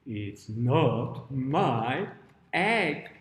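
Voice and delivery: very slow, drawn-out storybook pig; answering pig. Acted. Miss Pig answers Miss Hen, very slow and drawn out. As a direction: stretch every word out as the pig. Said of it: "And Miss Pig says".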